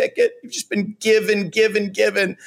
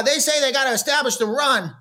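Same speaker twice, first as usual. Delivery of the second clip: a lightly voiced kid, quick; half-done kid impression; little kid voice; 3 semitones higher, faster than usual. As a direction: chirp it like a small child pundit. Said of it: "a little kid going".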